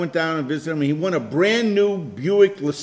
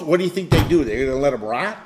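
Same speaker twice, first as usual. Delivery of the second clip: ordinary delivery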